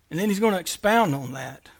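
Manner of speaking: usual speaking voice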